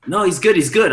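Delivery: high, loud brush-off; insistent reassurance. Retold or imitated. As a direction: imitate staff fending off his worry. Staff reassuring the panicking DJ.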